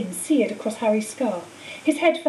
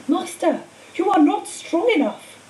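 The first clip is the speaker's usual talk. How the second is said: anxious servant; 6 semitones higher than usual. A narrator's reading. Servant pleading with master.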